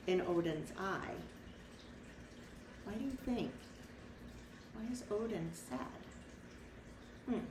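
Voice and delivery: usual speaking voice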